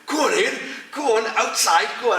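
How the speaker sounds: high, loud mock crowd egging him on. Doormen urging Ian to go fight outside, high loud egging on.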